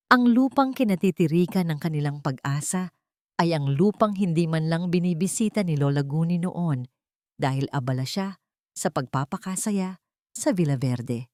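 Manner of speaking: ordinary delivery, nothing marked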